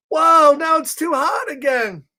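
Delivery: a high startled whoa; startled pigeon. Acted. Pigeon in the bath book, startled by hot water. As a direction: jump back from hot water.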